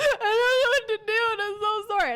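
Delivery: high, loud hammed-up crying; mock sobbing. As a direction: wail it through fake tears. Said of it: "She burst into tears".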